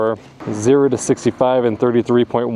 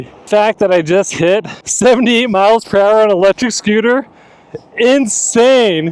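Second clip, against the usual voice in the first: excited; 6 semitones higher, 5 dB louder than usual. Real, not acted. Just hit top speed.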